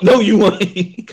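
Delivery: slow, drawn out through laughter; laughing. Real, not acted. Friend laughing at a fake Midwest claim, slow laughing denial. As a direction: laugh it out slowly.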